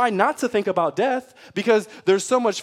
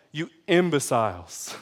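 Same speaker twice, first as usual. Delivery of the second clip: scornful; 3 semitones lower than usual. Pretend. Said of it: "with a tone of like".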